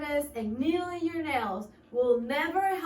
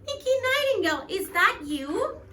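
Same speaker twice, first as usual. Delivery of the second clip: much higher, small squeaky worm; tiny voice; 9 semitones higher, 5 dB louder than usual. Acted. Little worm calls to the bird in a tiny voice. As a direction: squeak up from the ground. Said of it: "spoke up in a tiny voice".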